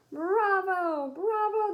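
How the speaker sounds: loud, high cheer from the audience; cheering bravo. Acted. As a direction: shout it out like a thrilled crowd. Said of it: "they cheered".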